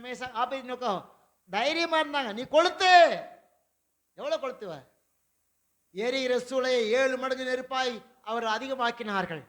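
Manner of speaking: ordinary talk, nothing marked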